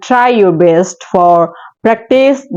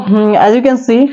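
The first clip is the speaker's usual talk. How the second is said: ordinary talk, nothing marked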